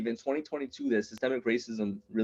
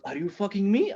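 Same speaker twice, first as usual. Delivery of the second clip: put-on high accented question; hurt friend in a foreign accent; Ahmed; 8 semitones higher than usual. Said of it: "kinda fresh off the boat".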